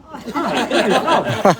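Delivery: high, loud, comically pressing question; escalating insistence. Retold or imitated. Kayaker asks the Scot yet again, comically insistent.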